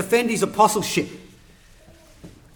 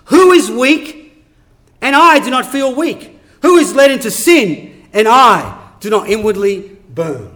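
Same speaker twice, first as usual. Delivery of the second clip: loud, forceful rhetorical questions; impassioned challenge; 6 dB louder than usual. Acted. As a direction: ring it out as a sermon climax. Climax of Paul's list, read out.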